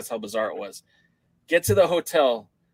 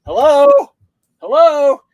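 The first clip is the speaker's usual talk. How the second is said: loud, high calls into an empty lobby; retold shout for help; yelling hello; 14 semitones higher, 13 dB louder, slower than usual. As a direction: call out for anyone at the front desk. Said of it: "I start yelling".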